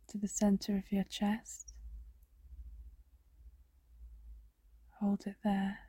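soft breathy meditation whisper. Acted. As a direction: hush it to a whisper.

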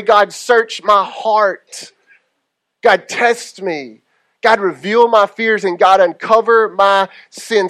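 very slow, earnest praying; slow prayer. Acted. Pastor modeling a brave prayer, slow and earnest. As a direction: lay each plea down slowly.

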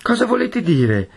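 high, alarmed question; exclaiming. A narrator's reading. Mother challenges the furious young man, exclaiming high. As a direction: demand an answer in alarm. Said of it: "esclamò Agnese".

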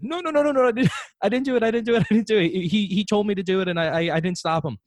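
put-on high, frantic little-kid denial; panicked kid voice. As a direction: plead your innocence like a scared kid. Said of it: "And I was like".

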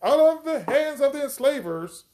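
high-pitched, jubilant crowd cry; shouted victory. Acted. Freed people cheer leaving their enslavers, shouting victory. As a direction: celebrate freedom in a high, ringing chant. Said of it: "shouted victory".

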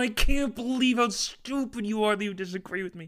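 mocking someone who disagrees with him, high and loud; mock smug insult. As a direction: play a smug know-it-all, loud. Parodying a smug arguer.

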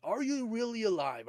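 usual speaking voice

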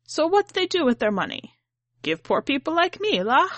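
put-on high, lilting old grandma, scornful of the rich. A narrator's reading. Grandmother to grandchild, complaining about the rich, high lilting voice. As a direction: voice Ahma mocking rich people's money.

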